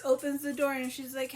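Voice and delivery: ordinary talk, nothing marked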